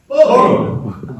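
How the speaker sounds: big high shout of boom. Shown as a demonstration. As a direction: bellow it out to lead the room. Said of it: "shout boom as loud as you can".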